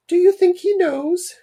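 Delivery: very high, loud falsetto mum; put-on falsetto question, hammed up. Mum asks Dad about Richard, high falsetto mum voice. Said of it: "I was doing a voice for the mum".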